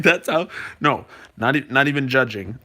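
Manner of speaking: ordinary talk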